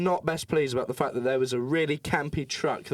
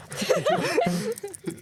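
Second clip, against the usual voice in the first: very high hammed-up cackle; imitated laugh. Narrator imitating a celebrity laughing, in a very high cackle. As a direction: mimic a man's big laugh. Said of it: "like that".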